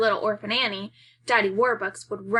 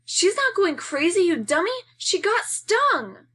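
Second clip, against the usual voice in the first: much higher, louder, scornful shout; exasperated yell; 9 semitones higher, 5 dB louder than usual. Acted. Brother yelling at sibling.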